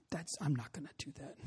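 hushed, whispered protest; reluctant refusal. Retold or imitated. Youth pastor balking at an inner nudge, whispered reluctance. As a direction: balk under your breath.

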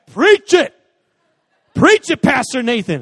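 high, loud churchy cheer; hammed-up congregation hype; mock amen. Son cheering his pastor dad like a congregant, loud and high.

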